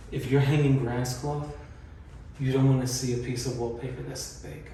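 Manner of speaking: ordinary talk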